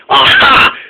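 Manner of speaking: high, loud gotcha cry; triumphant aha. Acted. Spencer catching Norman and Salty, loud triumphant cry. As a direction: pounce on them with a cry. Spencer finds the hiding diesels.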